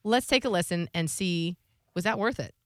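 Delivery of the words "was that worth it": ordinary delivery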